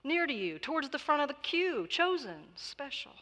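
high, insistent list of pleas; pleading. A narrator's reading. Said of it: "pleaded the pilgrim".

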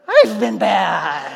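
laughing confession. Retold or imitated. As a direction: ham it up with a grin. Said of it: "and he goes".

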